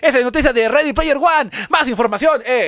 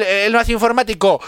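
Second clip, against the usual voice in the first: ordinary delivery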